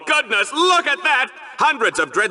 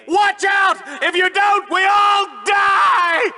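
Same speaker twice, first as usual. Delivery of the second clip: frantic shouted warning; 11 semitones higher, slower than usual. Acted. Host warning the audience out of character, shouting. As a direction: scream the warning at everyone. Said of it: "break character for just a second".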